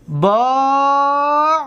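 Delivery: با stretched out at the end of the phrase; slowly drawn teaching demo. Shown as a demonstration. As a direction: drag out با so students hear the form. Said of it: "چطور که اینجا ده کردیم".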